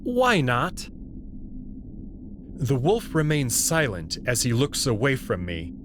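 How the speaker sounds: usual speaking voice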